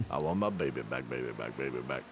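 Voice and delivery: sing-song it in a deep fake Scottish brogue; mock Scottish accent. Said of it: "work on your Scottish accent".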